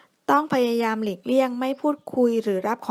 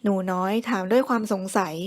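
ordinary delivery

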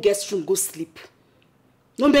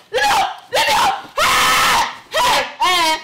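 very high, loud cries of protest; struggling yell; 13 semitones higher, 5 dB louder than usual. Acted. Grappling over the key, screaming in protest. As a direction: shriek to be released.

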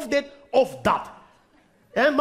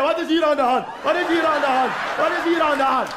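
mock TV host. TV renovation host bursting in, mock indignant. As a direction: storm in and demand answers.

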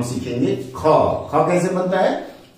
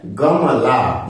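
गमला stretched very slowly; teacher sounding out गमला slowly for learners; 4 semitones lower, much slower than usual. Shown as a demonstration. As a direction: draw out गमला for the class. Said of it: "ग से क्या बनता है?".

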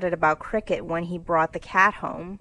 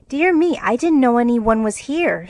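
startled, higher and louder outburst; flustered; 4 semitones higher, 7 dB louder than usual. A narrator's reading. Jo finds someone already hiding there, flustered. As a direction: blurt it out in flustered surprise. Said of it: "stammered Jo".

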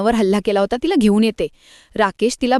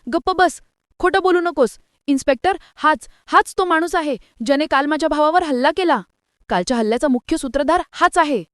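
shrieking; 7 semitones higher than usual. A narrator's reading. Sister accuses the man before the inspector, screaming high. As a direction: scream him down, pointing him out. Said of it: "Anjali किंचाळते".

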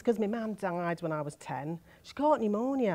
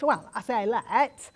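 ordinary talk

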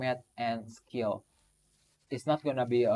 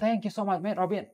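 excited; 9 semitones higher, 5 dB louder than usual. Real, not acted. Ultimate helped by Robin.

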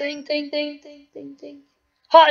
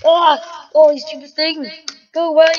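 ordinary talk

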